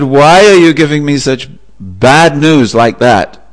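upset at the blunt news; exasperated complaint. Acted. Owner scolds the neighbour.

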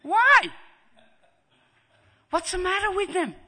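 very high, loud wail of bewilderment; shrieked, high-pitched acted question; exasperated parents. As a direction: cry out like a parent at wits' end. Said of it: "and the parents say".

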